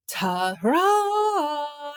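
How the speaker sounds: high, long, held "ta-ra" fanfare; triumphant announcement. A narrator's reading. Tom presents Billy to the street, a high sustained fanfare. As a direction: present it with a flourish. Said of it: "announced Tom".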